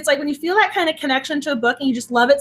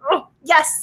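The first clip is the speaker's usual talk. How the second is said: usual speaking voice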